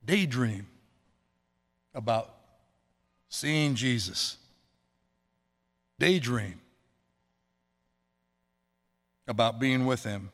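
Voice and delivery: slow, gentle and drawn out; dreamy guidance. Acted. Pastor leads the flock in a daydream, slow and gentle. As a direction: linger on each word like a daydream.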